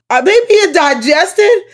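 very high, loud squealed question; mock-horrified disbelief. Viewer retelling her first thought on the scene, mock-horrified squeal. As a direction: squeal the dawning horror, hammed up.

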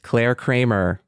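ordinary talk

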